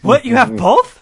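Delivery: loud, high mock astonishment; acted amazement. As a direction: play the thrilled, astonished customer. Acts an amazed store customer.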